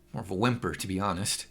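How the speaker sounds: ordinary delivery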